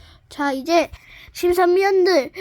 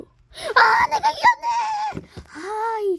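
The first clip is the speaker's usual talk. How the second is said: much higher, laughing shout of triumph; victory squeal; much slower than usual. Acted. Player shrieking with delight at winning. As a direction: squeal out the win.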